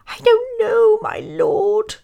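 high, squeaky little voice; humble mouse. A narrator's reading. Mouse replies humbly to the lion, squeaky. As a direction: answer meekly in a piping mouse squeak.

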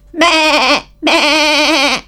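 high, excited bleat; bleating sheep. Acted. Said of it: "sheep bleating excitedly".

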